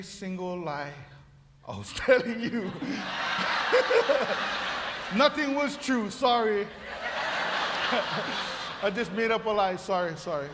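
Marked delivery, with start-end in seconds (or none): 2.8-5.1 airy wheezing laugh; breathy laugh. Real, not acted. Pastor admitting the story was a lie, breathy laugh. Laughing at his own joke. 6.7-8.8 breathy laugh. Real, not acted. Pastor owning up to the lie, breathy laugh. Laughing at his own joke.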